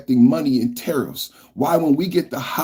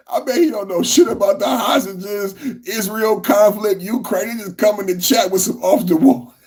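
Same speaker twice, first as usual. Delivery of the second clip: mock him while cracking up; laughing shout; 7 semitones higher than usual. Real, not acted. Laughing while mocking a chatter.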